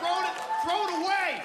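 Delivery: loud, high, exasperated yell; mock shout. Comic telling parents to dump the game, loud yell. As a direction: bark it at them like a fed-up advisor.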